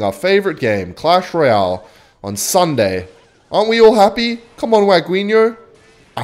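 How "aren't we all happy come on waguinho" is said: high, coaxing call; goading plea. Real, not acted. Player begs silent opponent for enthusiasm, goading plea. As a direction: pester them into cheering up.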